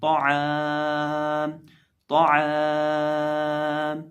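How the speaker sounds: ताआम, final मीम closed without vowel, very slow; pausal form. Shown as a demonstration. Teacher shows the pause form to students, drawn out. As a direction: stop on a silent मीम in ताआम. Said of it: "वक़फ़ की सूरत में मीम को जज़म देंगे".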